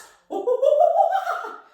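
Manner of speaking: very high loud chimp cackle; chimp laugh. Shown as a demonstration. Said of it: "Can you try that?".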